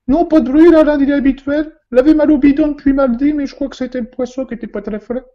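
high, loud falsetto widow; high woman's voice. Acted. Widow answering the inspector in a high, loud voice.

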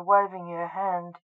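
ordinary delivery, nothing marked

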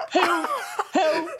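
tiny high-pitched plea, played for laughs; mock cry for help. Bandmate acting himself stuck in the holly bush, mock helpless cry. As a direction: squeak it out like a comic victim.

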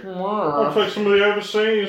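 slow, lightly put-on Williams voice; haughty star asking for a cut, slowly; Kenneth Williams impression. Pretend star negotiating with his agent, slow haughty voice. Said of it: "one was being Kenneth Williams".

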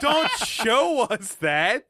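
slow, drawn-out plea through laughter; laughing protest. Real, not acted. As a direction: protest in amused disgust, stretching the words. Laughing protest at the insert shot.

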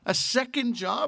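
high, disbelieving question; incredulous cousin. Retold or imitated. Cousin reacting to the bar job, high and incredulous. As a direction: pitch it way up in disbelief.

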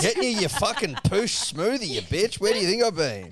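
hammed-up furious rant at a partner; retold outburst. Annoyed after waiting for the smoothie, mock furious shout. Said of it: "I'm like".

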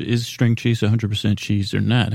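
usual speaking voice

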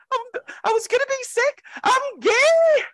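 very high-pitched mock panicked outburst; declaring out loud. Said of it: "me declaring out loud".

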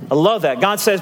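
normal talking voice

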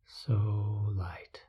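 airy whispered repeat; soft spa whisper. Acted. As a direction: breathe the words out softly.

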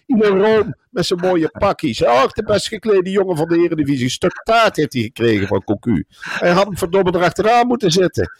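high-pitched, scornful tirade; mocking rant. Real, not acted. Pundit on air mocking the coach, high-pitched scornful rant. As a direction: pour pure scorn on the coach.

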